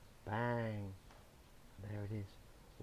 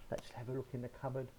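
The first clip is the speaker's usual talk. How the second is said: hushed wolf; 8 dB quieter, much faster than usual. Acted. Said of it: "and he says".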